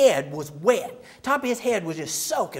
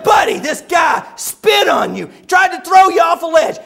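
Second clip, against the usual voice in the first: loud, high incredulous listing; exasperated; 6 semitones higher, 8 dB louder than usual. Acted. Preacher lists Buddy's injuries back to him, exasperated. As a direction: build up mock exasperation.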